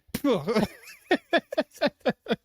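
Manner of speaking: a burst of high, loud laughter. Real, not acted. Laughing at the memory of dad's rage, high laughter.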